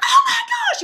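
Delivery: huge high scream of joy, laughing. Retold or imitated. Relatives hearing the news, screaming with joy. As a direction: shriek with delight. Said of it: "they just start screaming".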